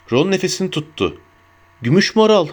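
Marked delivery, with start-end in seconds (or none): 1.8-2.5 higher-pitched burst of realisation; excited. A narrator's reading. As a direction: blurt it out with excitement. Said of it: "dedi heyecanla".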